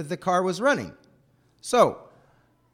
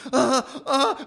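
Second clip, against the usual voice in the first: put-on stuck child panicking; 10 semitones higher, 7 dB louder than usual. Child stuck with foot on gas.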